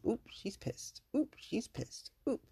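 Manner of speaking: high, hushed mock alarm; little high-pitched aside, softly; imitated onlookers. Onlookers noting her bad mood, high hushed aside. Onlookers noticing that she looked pissed.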